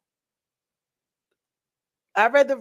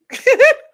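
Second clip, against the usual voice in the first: loud high laugh; 11 dB louder than usual. Real, not acted. Laughs at baby story.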